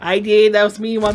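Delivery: loud, high proud claim; excited boast. Real, not acted. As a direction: brag about the kill loudly. Proudly claims a game kill.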